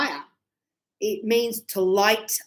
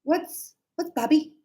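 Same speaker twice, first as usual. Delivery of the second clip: higher, softer, halting student voice; puzzled student; 5 semitones higher, 3 dB quieter than usual. Acted. Student asking teacher about barbie, puzzled and high. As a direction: ask it timidly high, like a confused learner. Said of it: "a student said to me".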